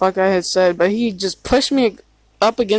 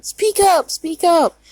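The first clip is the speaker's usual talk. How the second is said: much higher-pitched mock demand; nagging imitation; 10 semitones higher, slower than usual. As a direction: mimic a complaining mother, pitched way up. Imitating Mom's complaint about quietness.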